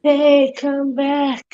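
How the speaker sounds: high, drawn-out mock plea; patronizing sarcasm. Quoting the skit to someone leaving, patronizing sarcasm. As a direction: call after them with fake, stretched sincerity. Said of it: "patronizing and sarcastically".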